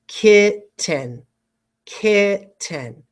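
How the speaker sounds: KITTEN split as "kit... ten", with a full second vowel; syllable-by-syllable dictation. Shown as a demonstration. Teacher giving students a spelling word, KITTEN carefully split.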